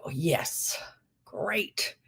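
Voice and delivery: whispered dry sarcasm; sarcastic whisper. Retold or imitated. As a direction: mutter it under your breath, sarcastic. Retold sarcastic thought at auction.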